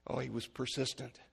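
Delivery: ordinary talk, nothing marked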